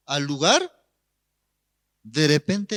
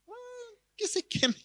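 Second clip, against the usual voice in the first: dismissive; 8 dB quieter than usual. Retold or imitated. Police indoors dismiss the shouting man.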